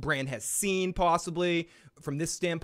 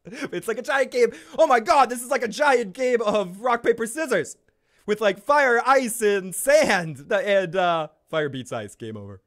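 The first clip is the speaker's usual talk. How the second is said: high, loud excited retelling; excited retake; 8 semitones higher, 8 dB louder than usual. Shown as a demonstration. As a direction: say it again with full energy. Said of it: "I wanna say that right".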